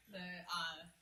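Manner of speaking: ordinary delivery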